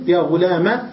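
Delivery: "غلام" said "ghulama", short a; fatha without ya. Shown as a demonstration. Teacher repeating the three vowels, short a ending. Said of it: "بالحركات الثلاثة بدون ياء".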